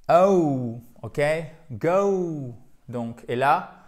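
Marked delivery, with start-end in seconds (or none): 0.1-0.8 "Oh" said loud, gliding from o to oo; drawn-out diphthong. Shown as a demonstration. Teacher showing the second diphthong, "Oh" loud and gliding. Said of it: "nous avons une seconde diphtongue". 1.7-2.5 "go" stretched, gliding from o to oo; drawn-out diphthong. Shown as a demonstration. Teacher applying the second diphthong, "go" with a long glide. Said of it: "nous avons une seconde diphtongue".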